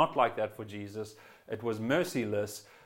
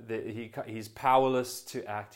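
normal talking voice

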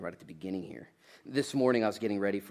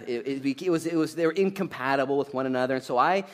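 ordinary talk, nothing marked

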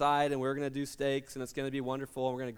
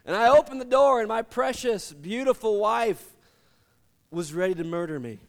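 louder, higher storytelling punch; building dramatic reveal; 4 semitones higher, 6 dB louder than usual. Real, not acted. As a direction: land it like a comic reveal. Dramatic reveal of angry wife.

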